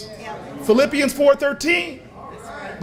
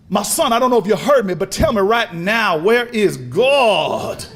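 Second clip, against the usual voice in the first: pastor doubling down; 3 semitones lower than usual. Acted. Pastor pressing the boy with Bible held up, doubling down. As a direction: press the question harder, stretching the last words. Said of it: "he just doubles down on it".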